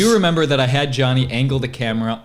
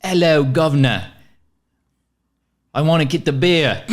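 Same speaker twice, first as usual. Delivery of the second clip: hammed-up Cockney accent, louder; British accent; 3 semitones higher, 4 dB louder than usual. Asked by friends to show his British accent, mock Cockney. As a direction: put on a cartoon London Cockney voice. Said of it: "introduce this podcast in a British accent".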